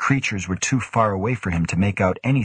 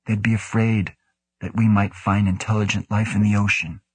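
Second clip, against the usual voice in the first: plain reading voice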